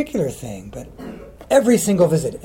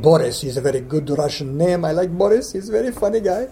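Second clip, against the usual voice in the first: put-on playful Russian accent, rising higher toward the end; 3 semitones higher, 3 dB louder than usual. Poet acting his Russian cousin Boris for young interviewers, Russian accent. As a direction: put on a cheery Russian character voice. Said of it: "a good Russian name".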